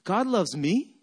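"God loves me?" rising on me like a question. Shown as a demonstration. Preacher shows congregation how the phrase came out, as a question. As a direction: ask "God loves me?" in doubt, ending up on "me". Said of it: "I said it like this as a question mark".

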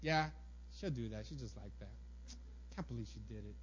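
low, muted grumbling; quiet annoyance. Acted. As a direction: grumble under your breath in acted irritation. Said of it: "the annoyance is like".

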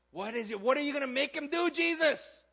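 mock shout climbing high and loud; demanding Pharisees. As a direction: build to an impatient, high-pitched demand. Pharisees demanding Jesus' verdict.

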